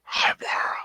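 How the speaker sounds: soft, fully voiceless greeting; whisper. Shown as a demonstration. Modelling for the struggling co-host, whisper. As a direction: answer in an airy ASMR hush. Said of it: "You gotta whisper".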